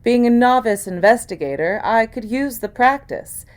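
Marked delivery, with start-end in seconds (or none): none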